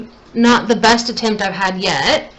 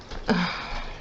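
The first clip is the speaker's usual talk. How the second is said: sigh; 3 semitones lower, 10 dB quieter than usual. Real, not acted. Eyeliner getting thicker.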